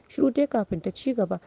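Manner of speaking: ordinary delivery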